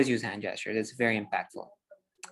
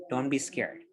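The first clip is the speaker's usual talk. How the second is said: ordinary talk, nothing marked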